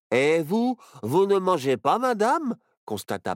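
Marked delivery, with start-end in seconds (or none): none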